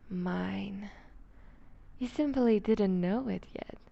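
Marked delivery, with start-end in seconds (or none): 0.1-0.9 possessive whisper. Acted. Captor claiming her prey, possessive whisper. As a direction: breathe it like a final claim.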